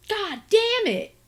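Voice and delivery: slow, high, loud frustrated cry; real outburst. Real, not acted. Reviewer after kicking the camera, a real loud yell. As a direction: yelp at the pain of kicking the camera. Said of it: "I just kicked my camera".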